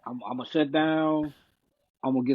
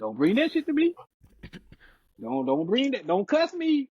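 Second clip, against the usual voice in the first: heated; 10 semitones higher than usual. Retold or imitated. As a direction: snap it back high. Heated retort to partner.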